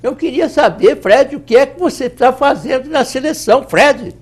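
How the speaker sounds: high cartoon sidekick voice; Barney voice. Shown as a demonstration. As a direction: call out as Barney to Fred. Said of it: "o Barney". (voz do Barney)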